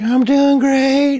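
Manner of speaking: put-on very high, slow, tipsy girlfriend voice; drunk girlfriend. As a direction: squeal it like a giddy drunk girlfriend. Drunk girlfriend answers him.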